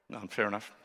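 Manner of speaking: ordinary delivery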